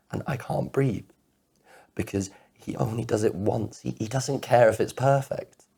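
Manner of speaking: high, strained distress; anguished jealousy. Acted. Man picturing her kissing someone else, distraught. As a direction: pitch climbing as the upset takes over.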